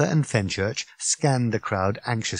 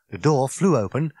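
usual speaking voice

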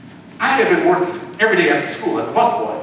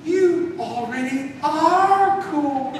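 much higher, tender motherly voice; mother's voice; 11 semitones higher, slower than usual. Acted. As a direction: reassure him like a loving mom. Mother reassures her son.

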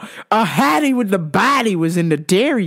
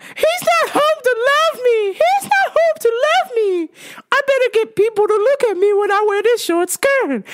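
high whining neglected wife; mock her lonely whine; whiny wife; 13 semitones higher than usual. Imitating the rich wife who feels neglected, high whine. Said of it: "you're like".

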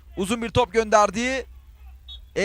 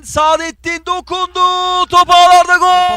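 high, loud, slowed goal call; screaming football commentator; 6 semitones higher, 7 dB louder than usual. Real, not acted. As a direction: stretch it out and scream gol.

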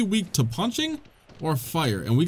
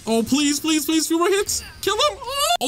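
high, frantic begging climbing to a squeal; desperate pleading; 13 semitones higher than usual. Real, not acted. Player pleading for a few more hits, desperate. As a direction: beg frantically, pitch climbing higher and higher.

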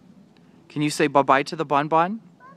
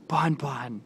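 whispering; 4 dB quieter than usual. Real, not acted. Whispered to toddler.

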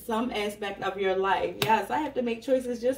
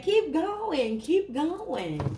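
high, falling singsong urging; lilting, higher-pitched call to continue; voicing Spirit; 6 semitones higher, much slower than usual. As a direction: chant it like a cheer from above, pitch swooping down. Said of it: "Spirit said".

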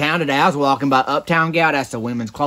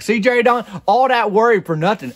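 teasing told-you-so; 8 semitones higher than usual. Retold or imitated. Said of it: "And I was like".

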